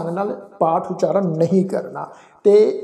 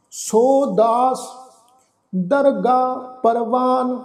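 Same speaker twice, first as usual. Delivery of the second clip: scripture recitation; 3 semitones higher, much slower than usual. Acted. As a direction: intone each phrase with long pauses.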